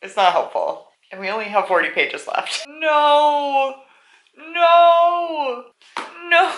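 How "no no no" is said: loud, high, drawn-out wail of protest; anguished cry. Real, not acted. Reader protesting the plot, anguished high cry. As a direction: wail it in disbelief.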